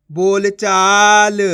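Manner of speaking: बोल चाल very slow and loud, चा stretched; blended word reading. Shown as a demonstration. Teacher joining the letters for learners, slow blending. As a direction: draw बोल चाल out as one joined word. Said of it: "आपस में लाके पढ़ दो".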